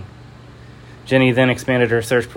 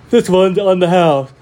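high, loud robot bartender; 8 semitones higher, 7 dB louder than usual. Acted. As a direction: boom it like an old robot. Robot bartender, loud first take.

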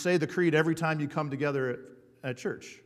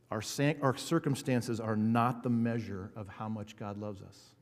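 ordinary talk, nothing marked

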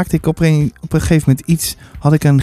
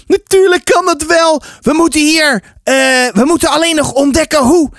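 put-on high, eager child's voice; insistent boy; 10 semitones higher than usual. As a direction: argue back like an excited boy. Boy insists it can be done.

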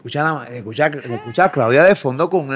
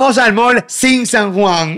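mock announcer; 6 semitones higher, 5 dB louder, much slower than usual.

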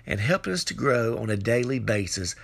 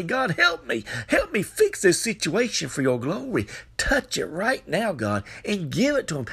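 high-pitched urgent plea; crying out to God; 7 semitones higher than usual. Acted. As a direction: pray it out like a fervent preacher. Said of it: "cry out to God and say".

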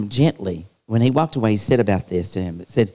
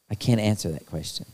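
"I can't answer that question." dropped much softer, almost to himself; quiet, lower echo of "I can't answer that question."; softer repeat; 3 semitones lower, 7 dB quieter than usual. Real, not acted. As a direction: let "I can't answer that question." fall away softly.

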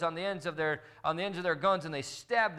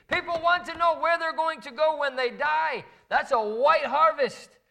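much higher and louder, driving sermon voice; full-force preaching; 10 semitones higher, 6 dB louder than usual. Real, not acted. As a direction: preach it hard to wake the congregation.

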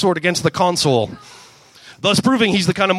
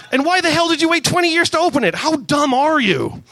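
higher, slowing scold; 10 semitones higher than usual. Acted. Gamora berating Star-Lord, higher exasperated scold.

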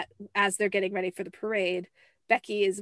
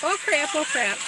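mock panic; 8 semitones higher, 5 dB louder than usual. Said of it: "everybody's freaking out".

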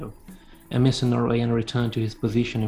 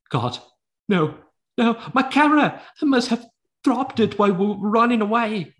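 dismayed professor; 12 semitones higher, 5 dB louder than usual. Acted. Professor dismayed over lost camera.

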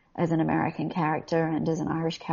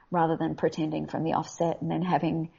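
ordinary talk, nothing marked

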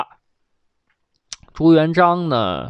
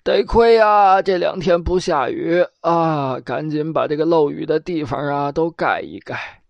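put-on muttering old man; 3 semitones higher than usual. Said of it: "嘴里还叨咕".

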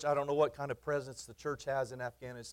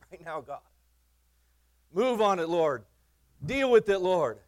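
loud, higher insistent commands to the Lord; demanding prayer; 5 semitones higher, 9 dB louder than usual. Retold or imitated. Preacher imitates his impatient praying, loud insistence. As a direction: bark orders at God, half-mocking yourself. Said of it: "I pray that way sometimes".

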